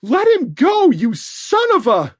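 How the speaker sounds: very high, loud furious yell; angry shout. A narrator's reading. Mother cursing at the captor, furious shout. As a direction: lose your temper and curse him.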